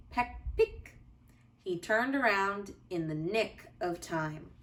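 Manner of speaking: put-on gentle, lively read-aloud for children; cozy storybook narration for little listeners; bedtime story reading. A narrator's reading. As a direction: read it like a bedtime picture book.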